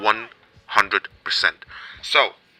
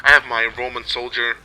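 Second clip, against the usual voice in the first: ordinary talk, nothing marked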